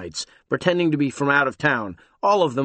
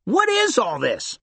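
alarmed; 11 semitones higher than usual. Acted. Tom alarmed by news.

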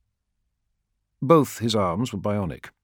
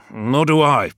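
louder, higher warrior voice; blunt warrior; 7 semitones higher, 7 dB louder than usual. Acted. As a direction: agree with gruff frankness. Zaitos's voice.

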